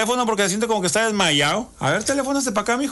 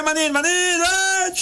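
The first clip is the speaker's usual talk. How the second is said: higher, hollering a friend's name from the street; calling out; 11 semitones higher, slower than usual. Retold or imitated. DJ retelling calling his buddy Manín at his door, higher. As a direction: call him out from outside the house. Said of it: "Ahí te grité".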